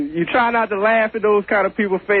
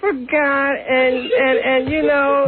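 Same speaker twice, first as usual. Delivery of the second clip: Beulah Schwartz; 7 semitones higher, slower than usual. Retold or imitated. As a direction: trail off pleading, voice up high.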